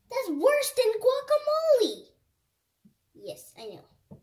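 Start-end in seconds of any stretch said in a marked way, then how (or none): 0.1-2.0 high, loud squeaky doll; shrill toy voice. Acted.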